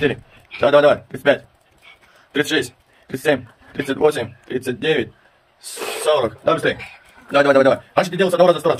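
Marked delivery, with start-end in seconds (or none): 6.4-8.8 loud urging. Real, not acted. Coach urging son through push-ups.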